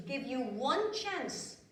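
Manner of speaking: word by word, very slowly; last chance, drawn out; slow final warning. Acted.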